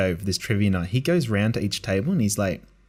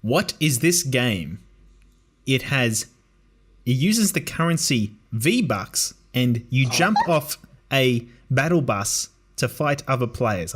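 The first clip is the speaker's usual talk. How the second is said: slow, deliberate quiz question; trivia question reader; slower than usual. Retold or imitated. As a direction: read it out like a quizmaster, pausing between clues. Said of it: "there was a question that was something like".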